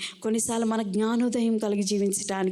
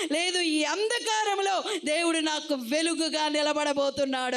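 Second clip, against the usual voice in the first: higher-pitched rising sermon; fiery preaching; 5 semitones higher than usual. Acted. Preacher rousing the congregation, higher-pitched preaching. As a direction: preach it up high to the crowd.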